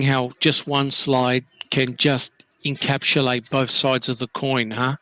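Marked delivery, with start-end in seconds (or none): none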